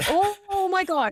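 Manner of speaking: imitating a terrified man; mock horror. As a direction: recoil in hammed-up alarm. Imitates his terrified reaction.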